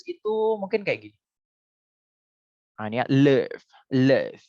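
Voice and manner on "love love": LOVE as "luhv" with a heavy final V; short central vowel in LOVE, thick V. Shown as a demonstration. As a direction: model LOVE as "luhv", not "lof". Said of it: "V-nya tebal".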